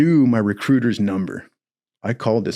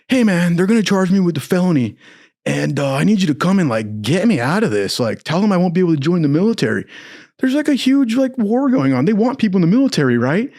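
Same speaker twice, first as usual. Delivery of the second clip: higher, rushed, desperate begging; frantic pleading; 7 semitones higher than usual. Retold or imitated. Jailed recruit begging recruiter to get him out, rushed and high. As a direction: talk fast to get out of trouble. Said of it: "I go".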